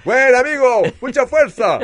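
cheery high encouragement. Retold or imitated. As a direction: cheer him on like a charity case. Gente lo anima con piedad. (ánimo condescendiente, agudo y fuerte)

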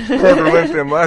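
begging through laughter; laughing plea. Real, not acted. Friend laughing, begging them to stop tormenting the child. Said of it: "repeated louder laughing".

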